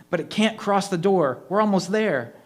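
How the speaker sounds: rally them, panicked but hopeful; urgent. A narrator's reading. Kids facing the ghost.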